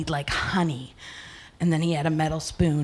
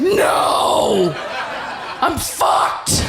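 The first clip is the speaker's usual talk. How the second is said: dismayed shout; 2 semitones higher, much slower than usual. Acted. Stoned woman realises her state at the party, dismayed shout. Sudden realisation after the cheering.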